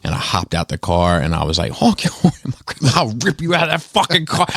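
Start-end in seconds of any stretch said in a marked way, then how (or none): 1.7-4.5 high, heated mock threat; threatening yell. Grandson threatening the driver who honked at grandma, high-pitched yell.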